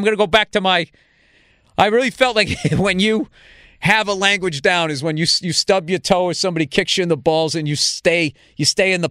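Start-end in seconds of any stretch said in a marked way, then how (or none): none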